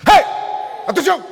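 sharp call for attention. Real, not acted. Wakes up the sleeping congregation. (llamado fuerte para despertar a la congregación)

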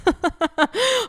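bright, high burst of laughter. Real, not acted. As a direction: laugh out with joy. Euphoric moment laughing.

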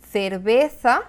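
cerveza with the Castilian th sound, /θeɾbeθa/; Castilian Spanish cerveza; Spain accent. Shown as a demonstration. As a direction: say cerveza like someone from Spain, both C and Z as th. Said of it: "Un español diría".

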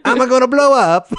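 very high, loud mock Italian cartoon accent; hammed-up Italian Pokémon voice; Italian Voltorb. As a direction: squeal it like a cartoon bomb about to pop. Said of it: "Voltorb's Italian".

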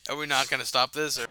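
ordinary delivery

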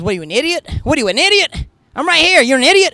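high, loud mock yelling, building; irate passenger. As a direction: imitate an indignant rider yelling insults. Retelling an irate passenger's insults.

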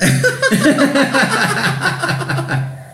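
long, high burst of laughter. Real, not acted. Laughing at stalking the fridge page.

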